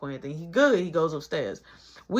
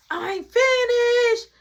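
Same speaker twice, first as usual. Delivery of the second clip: put-on high, slow, louder child whine; whiny little kid; 8 dB louder, much slower than usual. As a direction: stretch it out like a stubborn toddler. Little Caleb quoted.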